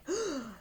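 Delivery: high shocked gasp; sharp gasp. Acted. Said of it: "he gasped".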